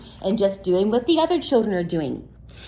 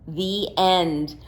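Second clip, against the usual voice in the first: ordinary delivery, nothing marked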